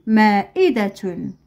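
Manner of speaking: "مائدة" slowly, joined into one word; slow whole word. Shown as a demonstration. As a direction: say "مائدة" carefully without breaks.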